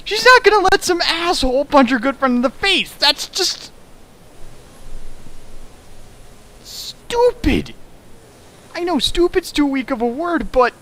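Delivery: real outraged yelling; exasperated rant. Real, not acted. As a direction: rant in disbelief at the plot.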